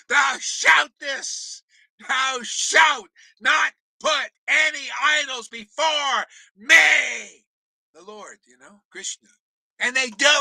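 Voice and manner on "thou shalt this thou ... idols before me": shouted commandment. Acted. Preacher booming the commandment against idols, slow shout. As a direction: proclaim it slowly like a divine law. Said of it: "Shout that".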